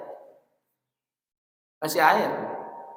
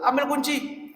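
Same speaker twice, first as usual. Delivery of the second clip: acted order in a higher pitch; retold command, pitched up without shouting; ustadz giving an order; 10 semitones higher, slower than usual. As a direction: lightly voice an ustadz sending someone off. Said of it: "Ustadz Ade suruh".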